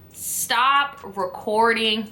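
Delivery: slow, spaced-out plea; slow, emphatic. Real, not acted. Vlogger giving viewers her last advice, slowly and emphatically. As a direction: space out every word for weight.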